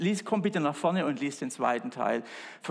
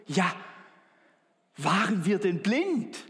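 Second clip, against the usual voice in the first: slow, higher, rhetorical waren wir denn blind; rhetorical question; 6 semitones higher, 3 dB louder, slower than usual. Shown as a demonstration. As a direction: ask it as if it were obvious. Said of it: "dieser Ausspruch". (langsam, höher, rhetorische Frage)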